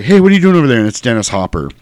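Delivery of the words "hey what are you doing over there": raised, louder challenge; suspicious guard. Retold or imitated. Security guard challenging a suspicious repairman, sharp call. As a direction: call out like a guard spotting someone. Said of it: "you got a security guard ... he's like".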